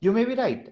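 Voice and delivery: YOU MAY BE RIGHT pitched high in an agreeing tune; high arc over MAY, then down toward RIGHT; falling-rising agreement. Shown as a demonstration.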